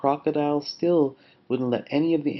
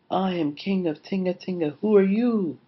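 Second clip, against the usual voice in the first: high echo swelling on the question; even bigger voice; 7 semitones higher than usual. A narrator's reading. Echo answers Lion, in an even bigger voice. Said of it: "came back an even bigger voice".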